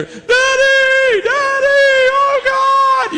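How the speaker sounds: very high, loud, drawn-out cries; crying out. Retold or imitated. Stoic youth guys overcome, crying out loud and high. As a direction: wail it out like men overcome in worship. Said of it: "All these guys that were like".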